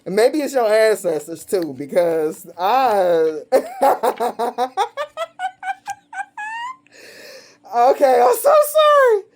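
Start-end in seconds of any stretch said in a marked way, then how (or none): 3.5-6.7 very high, long cackle; real helpless laughing fit; uncontrollable laughter. Real, not acted. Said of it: "Why am I laughing so much?". 7.7-9.2 very high, loud apology through laughter; real helpless laughing apology; laughing uncontrollably. Real, not acted. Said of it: "Why am I laughing so much?".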